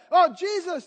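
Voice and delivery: high, loud mock plea; imitated calling out. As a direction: call out like a leper keeping back. Lepers calling from a distance.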